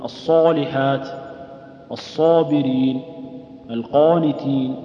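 الصالحات الصابرين القانتين slow, alif darkened toward و; over-heavy alif. Shown as a demonstration. Teacher demonstrating blameworthy heavy alif. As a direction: drag each alif thick and rounded. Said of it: "هذا تفخيم".

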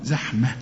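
زحمة with a crisp meem at the end; زحمة with its meem clearly closed. Shown as a demonstration. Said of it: "شوف الميم".

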